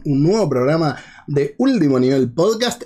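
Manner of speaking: ordinary talk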